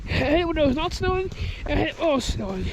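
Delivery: usual speaking voice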